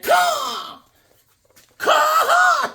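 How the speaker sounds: high screeching bird caw; hawk caw. Pretend. Showing his Hawkman drawing, a loud hawk cry.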